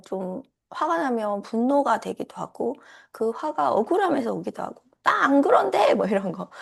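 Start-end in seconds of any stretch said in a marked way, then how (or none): 5.0-5.9 indignant protest. Retold or imitated. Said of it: "이런 목소리가 막 올라오면".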